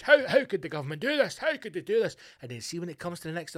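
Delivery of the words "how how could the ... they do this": higher-pitched imitation of indignant people; lightly hammed-up indignation; mock outrage. Mimicking people outraged at the government, in mock outrage. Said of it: "are outraged".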